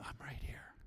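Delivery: soft unvoiced whisper, very intimate; whispered voice of the Lord. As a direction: breathe it like God whispering close. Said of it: "And the Lord reminded".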